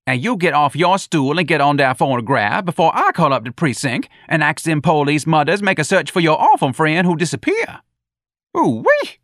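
high, loud voice throwing the threat back; animated comeback capped by a high whoop; loud, indignant character voice; Jones, defiant retort. A narrator's reading.

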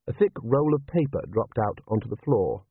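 ordinary delivery, nothing marked

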